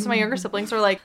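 normal talking voice

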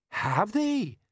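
high, different-sounding surprised question. A narrator's reading. Colon feigning surprise.

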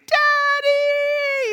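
put-on high, long-held child's scream; screaming kids. Twins scream after the man fleeing down the aisle, shrieking. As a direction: scream it after someone running off, stretched out. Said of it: "they're just screaming".

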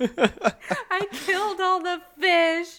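slow, high wail broken by laughter; fake-sob the confession while cracking up; mock crying child. Retelling the girl's confession, laughing through a mock wail. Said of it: "They start crying".

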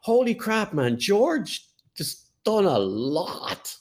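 high-pitched, drawn-out amazement, retold; amazed reaction. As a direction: act out being stunned by a discovery. Retelling his amazed reaction to Googling.

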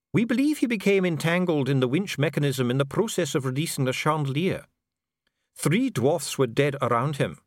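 a bureaucrat soothing a prisoner; calm official Dee. A narrator's reading. Dee answering imprisoned Vimes, coolly polite. Dee reports Skimmer's death.

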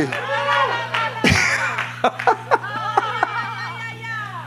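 high, shrieking holy laughter; overjoyed preacher's high laughing cry; ecstatic laugh. Real, not acted. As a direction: laugh out in joy. Joyful outburst during revival.

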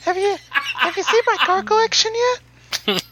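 high put-on talk-show host voice; chirpy host voice showing off cars; Jay Leno impression.

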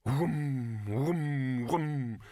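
rhythmic humming whooms in a row; droning whooms. Acted. The pot keeps wobbling, droning whooms. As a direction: hum it like machinery winding up. Imitates the machine.

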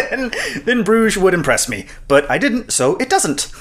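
high-pitched, cracking up mid-quote; laughing through a quote. Real, not acted. Quoting film line while cracking up.

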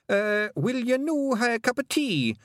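high, slow Scots pixie offering; Scottish pixie. A narrator's reading. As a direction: stall politely as a pixie. Feegle chief voiced in story.

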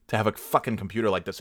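ordinary talk

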